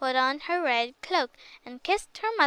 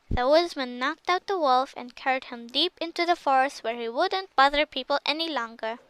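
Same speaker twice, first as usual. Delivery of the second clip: ordinary delivery, nothing marked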